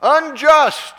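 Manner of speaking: loud, high, drawn-out cry of protest; mock scream. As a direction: cry it out slowly in outrage. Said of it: "something to scream".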